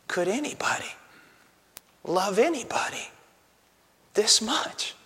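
breathy hushed whisper; preacher whispering his amazement at such love. Real, not acted. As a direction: drop to a whisper in wonder. Preacher hushes mid-question.

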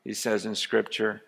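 low, soft, intimate preaching; hushed sermon. Acted. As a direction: draw them in close and quiet. Quiet intimate preaching again.

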